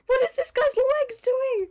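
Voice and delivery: high, baffled shriek of surprise; squeaky what-is-that voice; startled disbelief. Real, not acted. Weird enemy body in game.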